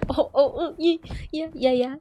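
ordinary delivery

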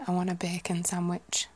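Yorkshire vowels in an even tone; Yorkshire accent. Shown as a demonstration. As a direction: order it like a Yorkshire local. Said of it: "a more Yorkshire-y area".

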